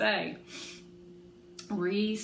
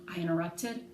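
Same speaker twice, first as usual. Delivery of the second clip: plain reading voice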